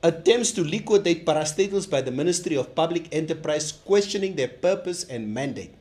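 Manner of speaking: reading an official press statement; solemn, deliberate statement delivery; formal statement reading. Acted.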